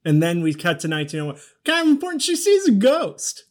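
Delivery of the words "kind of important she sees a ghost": high-pitched, louder exclamation; mock urgency. Co-host stressing the one thing that happens, mock-urgent. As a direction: shoot up high and stretch out the ghost reveal. Mock-emphatic recap of film's point.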